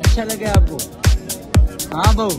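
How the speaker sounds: ordinary talk, nothing marked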